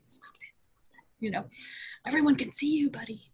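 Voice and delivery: urgent whisper, barely voiced. Mom whispering to her son picking his nose on camera. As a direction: hiss it so the call can't hear.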